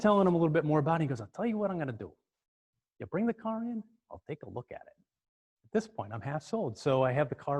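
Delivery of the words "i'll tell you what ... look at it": high New York voice, dropping softer; New York accent. Retold or imitated. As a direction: confide an offer as a New York mechanic. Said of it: "this kinda New York accent".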